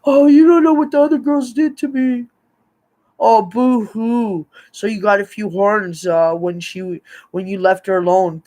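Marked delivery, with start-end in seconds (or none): 0.0-2.2 high, loud mock whining; put-on poor-me wail; whiny self-justifying imitation. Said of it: "he tries to justify himself". 3.2-4.5 slow, sarcastic boo-hoo; drawn-out mocking pity, pitched up; mock sympathy. Mocks his self-pity.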